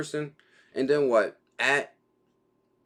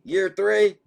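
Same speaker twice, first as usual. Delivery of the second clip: "Year three" repeated higher and louder, both words punched; excited emphasis; 8 semitones higher, 5 dB louder than usual. Real, not acted. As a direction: savor the milestone with a lift.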